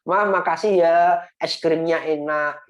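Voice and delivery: put-on child thanking mom for ice cream; sweet thank-you. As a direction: act the grateful kid, loud and cheerful.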